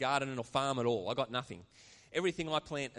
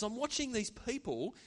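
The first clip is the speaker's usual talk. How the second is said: fighting back tears as the pitch climbs; moved, pitch high and straining; emotional; 6 semitones higher than usual. Real, not acted. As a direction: voice rising with emotion, close to tears. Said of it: "I actually started to get emotional and tears welling up".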